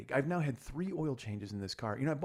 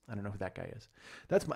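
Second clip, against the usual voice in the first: ordinary talk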